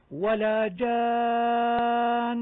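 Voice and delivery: جان with the aa held a full six counts, very slow; six-count madd. Shown as a demonstration. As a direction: hold the aa in جان long before the doubled n. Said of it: "المنتهي بحرف مشدد". (مد لازم ست حركات في جان)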